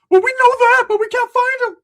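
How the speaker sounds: very high, loud frantic gibberish voice; squeaky Ricky. Retold or imitated. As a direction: babble it high and panicked.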